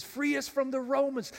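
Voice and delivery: high-pitched mock-British plea; British accent. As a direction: imitate the British-accented film line, pitched well up. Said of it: "they have a British accent".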